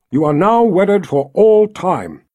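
projected, drawn-out officiant's delivery; ceremonial pronouncement. A narrator's reading. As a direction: pronounce them wed with weight. Richard pronounces the couple wed.

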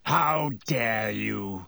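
low, slow menacing growl. A narrator's reading. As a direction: growl it like a big dog. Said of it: "he growled".

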